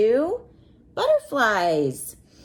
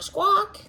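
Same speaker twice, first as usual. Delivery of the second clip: parrot squawk; 9 semitones higher than usual. Retold or imitated. Teaching a child, imitates a parrot squawk high. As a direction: squawk like a parrot, much higher. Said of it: "they like to squawk".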